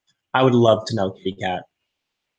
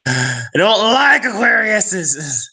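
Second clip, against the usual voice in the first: high, slow, drawn-out whine; mock dismay; 9 semitones higher, 4 dB louder, slower than usual. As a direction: wail it out in playful distaste. Mock complaint about the sign.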